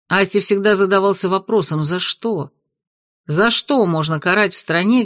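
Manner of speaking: ordinary delivery, nothing marked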